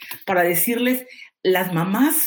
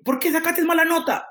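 higher, half-hearted scolding parent; mock scolding; 8 semitones higher than usual. Teacher mimics a parent scolding their child, mock-angry and higher. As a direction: imitate a parent snapping at a bad grade. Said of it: "con los papás cuando llamo".

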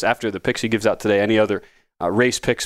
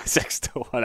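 "six to one" breathed softer through a laugh; 7 dB quieter, slower than usual. Real, not acted. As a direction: laugh it out, amused at the long odds. Teasing repeat of the odds.

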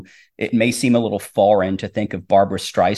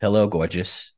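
put-on Brooklyn accent. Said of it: "in her Brooklyn accent and goes".

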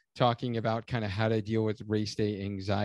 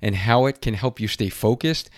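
ad read; 8 dB louder than usual. Acted. Host reading sponsor ad.

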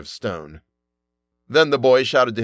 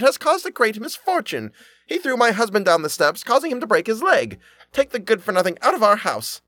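high accusing voice; shouted accusation; 11 semitones higher than usual. A narrator's reading. Sexton's wife shouts accusations at the father, high voice. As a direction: demand the boy be thrown out. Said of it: "she shouted".

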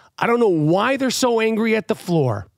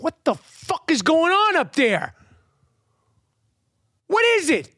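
high, slow, fed-up yell at the ceiling; rising irritated demand upward; exasperated outburst; 8 semitones higher than usual. Retold or imitated. Yelling at noisy upstairs neighbors.

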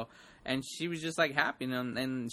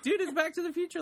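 high, rushed fan excitement; retold freak-out; faster than usual. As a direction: gush it fast, pitched way up. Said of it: "I was all freaking out".

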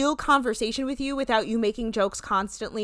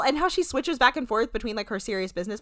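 ordinary delivery

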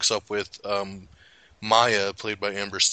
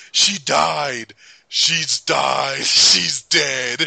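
mock slam poet; 4 semitones higher, 6 dB louder, much slower than usual. Slam poem climax, laughing.

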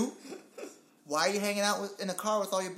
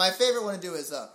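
ordinary delivery

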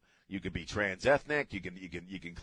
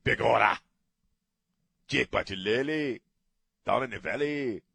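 loud, higher mock Mexican accent; 3 semitones higher, 4 dB louder than usual. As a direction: belt Irish phrases in a hammed-up Mexican accent. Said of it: "he's gotta say it like a".

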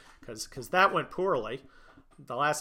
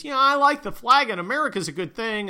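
quoted athlete; 8 semitones higher, 9 dB louder than usual. Retold or imitated. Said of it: "He comes up, he says".

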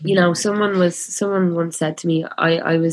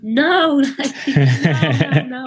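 high, laughing mock "no"; retold amused denial; 7 semitones higher, much slower than usual. Reassuring a girl at the gig, laughing high denial. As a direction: protest high, breaking into laughter. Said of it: "And I was like".

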